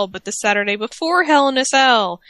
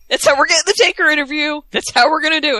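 excited scheming; 6 semitones higher, 4 dB louder, faster than usual. Real, not acted. Excited plotting.

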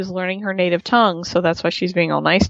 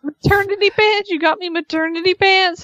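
higher, incredulous repeat; indignant disbelief; 9 semitones higher than usual. Retold or imitated. Wife offended by the maternity pants, indignant mimic. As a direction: sound insulted by the gift.

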